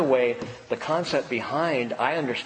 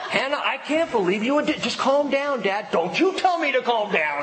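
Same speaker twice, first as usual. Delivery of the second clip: high, laughing play of daughter and riled dad; a hint of hammed-up family squabble; mock exchange with daughter; 10 semitones higher than usual. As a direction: act out the daughter telling dad to calm down. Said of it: "my daughter Hannah used to say".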